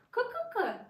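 high "Ка-ка-ка" clucking; hen clucking. Shown as a demonstration. Mother models hen sound.

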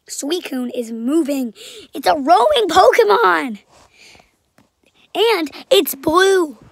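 loud excited reveal. Real, not acted. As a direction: burst out with the discovery.

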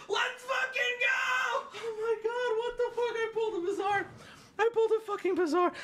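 very high, squealing celebration; real giddy disbelief; overjoyed freak-out. Real, not acted. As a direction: crow about your win in a strained high voice. Celebrating the card pull.

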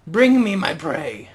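slow, loud, grand mock command. Gamer ordering his wolf like a chieftain, hammed-up command. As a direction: play a hammed-up beast master.

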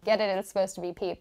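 ordinary talk

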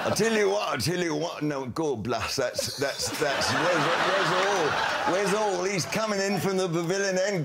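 put-on West Country yokel cricket commentary; West Country common voice. Shown as a demonstration. Cricket commentator at Lord's disguising himself, in a West Country common voice. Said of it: "West Country now".

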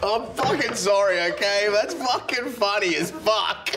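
high, slow, giggling apology; can't stop laughing at own joke. Real, not acted. Teller cracking up at own joke.

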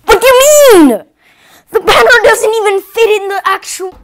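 loud, high near-crying rant; panicked outcry. Acted. Host wailing at the broken banner. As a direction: wail it in exasperation.

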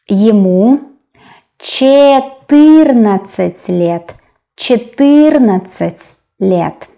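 careful, slow model of "четырнадцать"; slow sounding-out. Shown as a demonstration. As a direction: draw out "четырнадцать" for a learner. Teacher sounds out new number.

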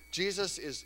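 ordinary talk, nothing marked

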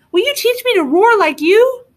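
put-on high, loud eager child voice; Rex. A narrator's reading. Little dinosaur asking dad for roaring lessons, high eager voice. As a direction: ask like a keen little dinosaur. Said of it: "said Rex".

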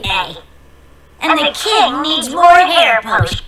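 witch voice. Acted. Witch gives orders.

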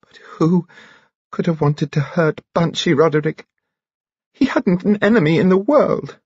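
high, distraught lament; tearful. A narrator's reading. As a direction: plead through tears, pitch well up. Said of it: "a face streaming with tears".

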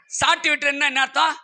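normal talking voice

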